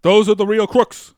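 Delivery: put-on rally the crowd like a fiery old senator; mock campaign-trail indignation; Bernie Sanders. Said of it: "I am Bernie Sanders".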